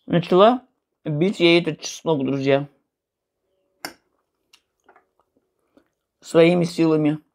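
eating between words; talking while chewing. Real, not acted. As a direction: mouth full of food. Seen 0: bites food at -2.0 and a pickle at 4.2, chewing throughout.

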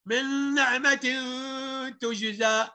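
tajweed with held n sounds; recite, lingering on the nasal sounds; Quran recitation. Acted. Teacher modelling a verse, measured recitation.

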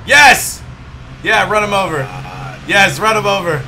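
loud, high excited cheering. Real, not acted. Cheering in game.

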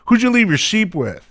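high, sneering jab at the little brother; mocking. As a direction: needle him like a scornful older brother. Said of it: "kinda mocking David there".

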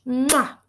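loud smacking kiss; kiss sound. Acted. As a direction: blow a big kiss.

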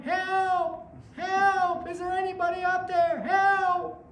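high, frantic cries for help; man hanging off a cliff yells for help; yelling for help. Retold or imitated. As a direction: cry out from the cliff edge. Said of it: "And he's yelling".

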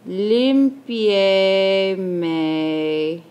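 "limpiémelo" stretched out, syllables running on, pause before lo; very slow dictation. Shown as a demonstration. Teacher saying the word as she writes, "limpiémelo" very drawn out. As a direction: draw out every syllable of "limpiémelo".

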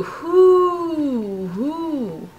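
a rising breathy wind hoo; wind noise. Acted. Parent making the wind noise for the child, playful. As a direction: blow the words like the wind.

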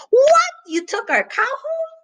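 very high, loud outburst on the first word; outraged squawk, easing in volume; furious. Acted. Farm dog learns his cow was taken, furious. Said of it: "Plato was furious".

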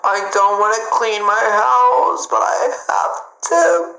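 high, drawn-out whine; mock-wailing reluctance; whiny complaint. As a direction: whine like a kid facing chores. Whining about cleaning the house.

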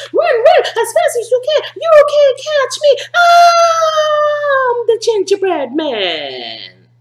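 high, loud singsong taunt; the gingerbread man mocks everyone chasing him, in a high, loud singsong. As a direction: tease the chasers in a high cheeky voice.

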